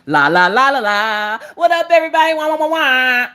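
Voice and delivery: loud, high sing-song silliness; over-the-top livestream greeting; going-live act. Retold or imitated. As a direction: burst out in goofy, high la-las and wahs. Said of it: "once I get in the live, I'm like".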